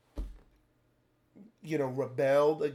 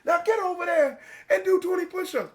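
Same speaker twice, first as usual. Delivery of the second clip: high shouted military reply; loud gung-ho soldier; 12 semitones higher, 6 dB louder than usual. Retold or imitated. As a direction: bark it like a fresh recruit. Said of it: "you're gonna be like".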